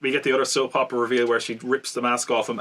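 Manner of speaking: usual speaking voice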